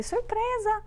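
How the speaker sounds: put-on spring the surprise on the child; surprise call. Mother surprises her son with the haircut, excited. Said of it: "eu falei".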